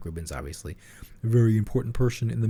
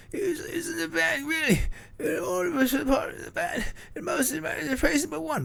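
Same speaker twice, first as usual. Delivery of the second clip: much higher, put-on [name] voice; [name] impression. Doing [name] for his co-host, high and put-on. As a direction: squeak it out like [name]. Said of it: "just do your [name] impression".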